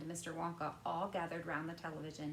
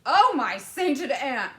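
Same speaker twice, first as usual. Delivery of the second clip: slow, much louder dramatic exclamation; Wonka's horrified cry; 7 semitones higher, 17 dB louder, slower than usual. A narrator's reading. Wonka appalled by the mention of cereal, crying out loudly. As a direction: draw out the horror, loud and slow. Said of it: "cried Mister Wonka".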